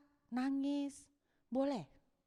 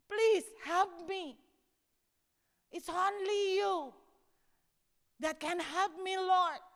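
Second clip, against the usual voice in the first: slow, raised plea; crying out; 6 semitones higher, 5 dB louder, much slower than usual. Acted. David to the Lord, crying out for help. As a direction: call out to God, drawn out and higher. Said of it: "Mungkin dia teriak begitu".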